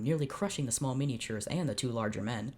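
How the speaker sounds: ordinary delivery, nothing marked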